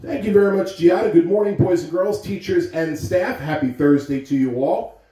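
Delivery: bright, loud morning announcement; announcer voice. Real, not acted. Principal greeting the whole school over the PA, loud and bright.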